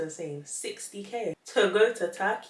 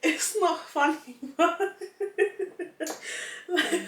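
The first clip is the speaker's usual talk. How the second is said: giggling higher through the words; can't stop laughing while protesting; real giggles; 9 semitones higher than usual. Real, not acted. Cracking up at the message.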